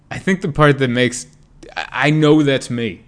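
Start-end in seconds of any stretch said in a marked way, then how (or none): none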